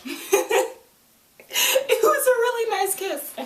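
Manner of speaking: high, laughing; giddy gush. Real, not acted. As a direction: gush with a giggle. Laughing gushing about kiss.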